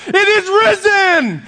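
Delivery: very high, slow, loud shout. Retold or imitated. Greeter turning to the next guy, very loud shout. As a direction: imitate a man hollering his Easter greeting at full volume. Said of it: "in an even a louder voice".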